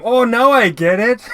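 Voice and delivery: light up as the penny drops; excited realization. Real, not acted. Realizes the hammer time joke.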